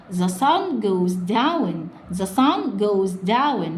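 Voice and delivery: slow reading. Shown as a demonstration. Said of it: "slow model reading".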